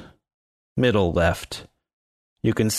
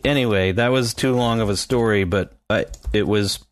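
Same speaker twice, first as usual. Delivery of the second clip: ordinary delivery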